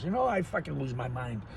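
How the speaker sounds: ordinary talk, nothing marked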